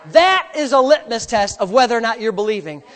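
usual speaking voice

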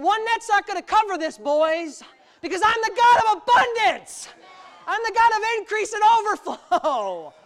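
high, loud preacher's shout as God; booming proclamation. Acted. God tells the boys of abundance, shouted proclamation. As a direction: build to a full-force, triumphant cry.

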